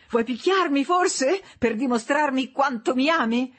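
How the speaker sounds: high, goading jeer; mocking. A narrator's reading. Wife daring her husband to hit her, mocking. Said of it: "lo burlò lei".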